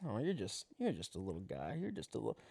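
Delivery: very soft, indulgent murmur; tender cooing. Acted. Talking to the caged raccoon, softly cooing. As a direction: go quiet, as if melting over a small animal.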